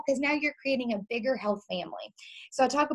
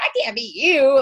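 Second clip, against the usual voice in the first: louder put-on friend voice, a touch higher; pleading protest; 4 semitones higher, 9 dB louder than usual. Friend telling her coach she can't, mock pleading. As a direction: imitate her frightened insistence. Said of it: "She's like".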